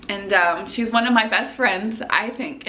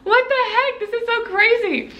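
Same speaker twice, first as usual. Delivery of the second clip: high-pitched, amazed voice; overwhelmed disbelief; 13 semitones higher than usual. Retold or imitated. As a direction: retell your own astonished reaction. Own astonished reply, retold.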